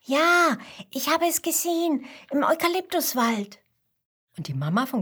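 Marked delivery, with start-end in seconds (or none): 0.0-3.6 put-on high, eager child voice; breathless-with-news youngster, pitched up high; excited little koala. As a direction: burst out with the news to your father. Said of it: "erklärt ihm aufgeregt".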